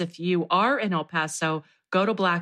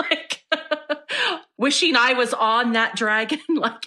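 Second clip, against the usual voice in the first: laughing; 5 semitones higher than usual. Real, not acted. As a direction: admit it while breaking into laughs. Laughs while admitting dragon envy.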